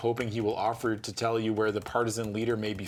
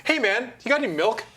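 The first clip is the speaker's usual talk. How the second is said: a lightly higher casual prankster; 11 semitones higher, 5 dB louder than usual. Pretend. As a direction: ask it like a cheeky ghost. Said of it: "invisible prank voice".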